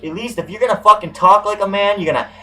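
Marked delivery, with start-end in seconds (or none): none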